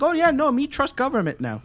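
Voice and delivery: high, loud caveman-style parody; mock dumb voice. Parodying a brainwashed friend, high mock voice.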